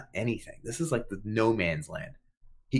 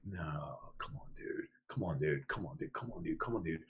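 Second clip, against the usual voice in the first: retold plea under the breath, much softer and lower; hushed pleading; 5 semitones lower, 13 dB quieter than usual. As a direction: urge him on in a barely voiced, repeated murmur. Tense muttered plea while slab rises.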